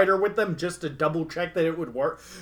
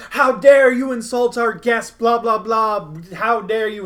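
loud, higher indignant ghost scolding; mock angry scolding; 6 semitones higher, 7 dB louder than usual. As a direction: bellow it like an outraged undead servant.

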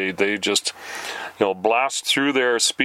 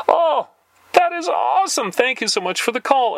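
mock elated; 7 semitones higher than usual. Telling the telemarketer about bankruptcy, in mock giddy joy. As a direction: gush happily about bankruptcy to troll. Faking glee over bankruptcy.

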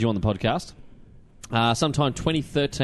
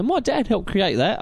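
slow, mock British accent, a little higher; playful put-on British accent; 7 semitones higher, slower than usual. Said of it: "I keep doing a British accent".

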